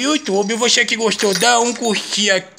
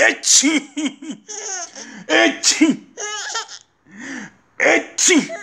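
high playful clown call; 7 semitones higher, much slower than usual. Acted. Clown dad making baby laugh.

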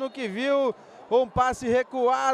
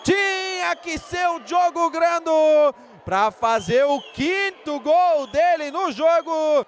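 play-by-play; 3 semitones higher than usual. Real, not acted. Shouted scorer name.

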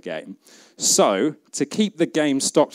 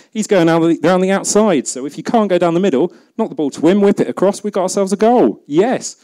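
higher and louder, building to a goal; getting carried away explaining the move; rising excitement, voice up; excited; 8 semitones higher, 6 dB louder than usual. Real, not acted.

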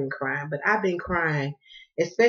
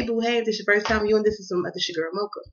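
ordinary delivery

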